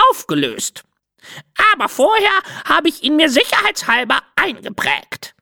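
high, self-important parrot voice; a parrot bragging about her professionalism; proud parrot. Acted. Parrot Cinzia boasting to the light-bender, high proud parrot voice.

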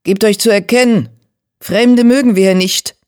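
stern challenge. A narrator's reading. Border cat confronts approaching strangers, loud and stern. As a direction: call out to warn off intruders.

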